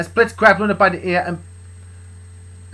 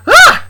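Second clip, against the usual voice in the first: short, high scream of fright; startled yelp; 16 dB louder than usual. Acted. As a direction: yelp loudly as if made to jump. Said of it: "scared and made me and Luna jump".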